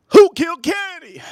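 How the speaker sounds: high, loud cartoon outrage; mock-outraged high-pitched shout; South Park quote. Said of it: "I love that on South Park".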